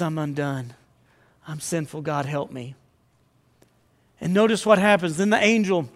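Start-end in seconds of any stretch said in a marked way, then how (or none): 0.0-2.7 softer, hushed, head bowed in confession; humbled confession. Acted. Preacher voicing Isaiah undone before God, soft and humbled. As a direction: murmur it like a broken man before God.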